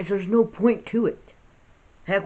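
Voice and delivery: usual speaking voice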